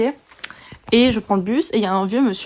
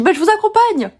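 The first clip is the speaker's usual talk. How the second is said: put-on high, louder old man's voice; old man insisting; 10 semitones higher, 6 dB louder than usual. Imitating the old man offering to walk her, high and loud. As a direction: play the pushy old man, pitch up. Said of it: "il m'a dit".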